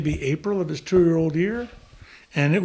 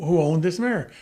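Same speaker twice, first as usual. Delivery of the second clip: normal talking voice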